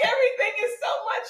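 high, fast laughing whimper; laughing whine. Retold or imitated. Telling her sister about her meltdown, laughing whine. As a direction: gasp it out between laughs. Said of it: "I'm like".

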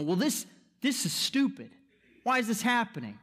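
a light imitation of an annoyed complainer; mock angry outburst. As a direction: huff it out like someone fed up with life. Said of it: "We lash out in anger. We go".

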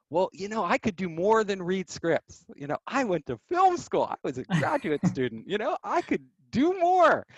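mock earnest pleading; pleading young self. Pleading with boss in retold scene.

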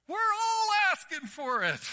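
put-on high, loud squeal of a delighted child; imitating a thrilled six-year-old shouting; gleeful kid. Six-year-old daring the preacher in a snowball fight, gleeful shout. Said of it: "he gleefully says".

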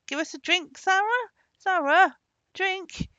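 insistent, high-pitched shouting; loud, escalating demands; shouted command. A narrator's reading. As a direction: yell repeatedly for a servant who won't come. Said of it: "No matter how they shouted and commanded".

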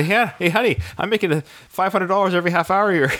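high, playful bragging voice; bragging to wife. Retold or imitated. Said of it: "So I'd say".

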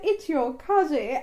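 slow, imitated transatlantic drawl; stretched-out transatlantic vowels; transatlantic accent. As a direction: quote it in a mock old-film transatlantic accent. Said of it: "when she said".

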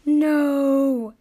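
long, loud drawn-out no; loud, emphatic objection; exclaimed refusal. A narrator's reading. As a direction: cry out in protest, holding the vowel. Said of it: "exclaimed at the exact same time".

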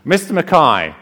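formally addressing the minister as Mister Mackay; old lady addressing minister. Retold or imitated. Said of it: "wants me to do a Scottish accent".

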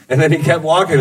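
ordinary talk, nothing marked

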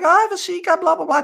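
high, airy mock girly voice; imitating girl bragging. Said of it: "She was like".

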